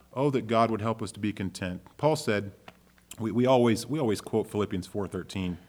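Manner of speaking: ordinary talk, nothing marked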